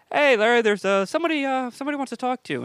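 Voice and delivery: high-pitched, hesitant lead-in; retold phone call. Comic retelling his awkward call to Larry, high and hesitant. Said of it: "I go".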